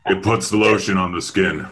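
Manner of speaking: low, menacing creepy movie-villain voice; lightly creepy villain line. Pretend. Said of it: "you just got that creepy voice".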